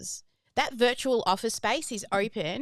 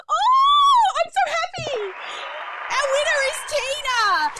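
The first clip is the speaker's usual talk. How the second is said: much higher, much louder burst of delight; joyful shout; 7 dB louder than usual. Real, not acted. Host thrilled that her nervous client Tina won, much higher joyful shout. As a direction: shriek for joy at the winner. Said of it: "Do you know why I'm so happy".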